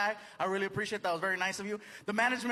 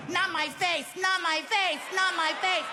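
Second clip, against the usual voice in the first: higher, pleading "Not my face" in a mock film voice; film actress pleading; 8 semitones higher than usual. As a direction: parody the woman in the film begging "not my face". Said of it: "it was bad for you".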